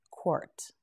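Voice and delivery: a soft breathy Quart with no voice; whispered word. Shown as a demonstration. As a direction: whisper Quart barely audible. Teacher closes word entry.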